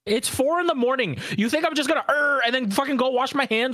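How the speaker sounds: high, heated rant; indignant rant. Real, not acted. Defending himself to friends, high heated rant. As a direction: rant at the friend who judged you.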